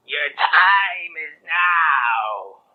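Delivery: deep, slow, booming death voice; ominous drawn-out voice of death; angel of death. Acted. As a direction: loom over him, dragging each word.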